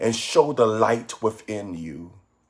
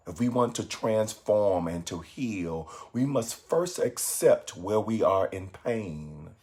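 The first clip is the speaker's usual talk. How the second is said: softer, measured meditation voice with long pauses; guided meditation; 3 semitones lower, 7 dB quieter than usual. Acted. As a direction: guide listeners gently inward, phrase by phrase.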